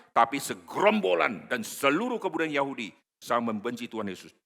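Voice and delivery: loud, high, driving sermon; fervent preaching. Real, not acted. Preacher exhorts the congregation, loud fervent preaching. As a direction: preach it to the rafters.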